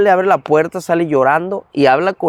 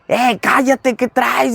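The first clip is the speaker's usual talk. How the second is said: annoyed brother; 7 semitones higher, much slower than usual. Retold or imitated.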